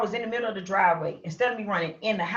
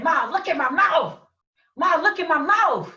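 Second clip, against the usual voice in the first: high loud urgent plea, much higher; pleading call to mother; 9 semitones higher, 6 dB louder than usual. Retold or imitated. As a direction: beg her to look, calling out. Showing busted mouth to scared mother.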